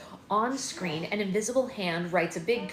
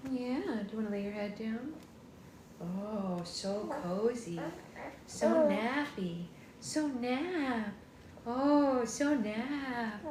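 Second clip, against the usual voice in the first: soothing coo; 2 semitones higher, 3 dB quieter, slower than usual. Real, not acted. Soothing baby to sleep.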